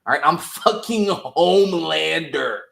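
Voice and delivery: imitated furious villain; mock menacing Homelander. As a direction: threaten the son who lied. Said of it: "he goes".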